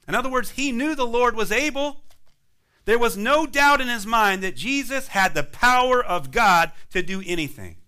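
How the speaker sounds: usual speaking voice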